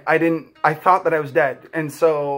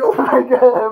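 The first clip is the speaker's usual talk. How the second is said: laughing joy; 8 semitones higher, 6 dB louder, slower than usual. Retold or imitated.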